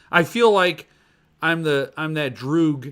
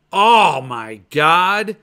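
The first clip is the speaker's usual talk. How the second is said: awed; 8 dB louder, much slower than usual. Real, not acted. Awe at the book's pages.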